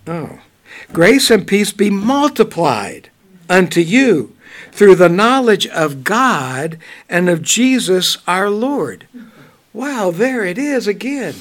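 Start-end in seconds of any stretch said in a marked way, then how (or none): 0.9-9.1 scripture reading. Acted. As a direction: read the verse out with weight. Reads scripture aloud.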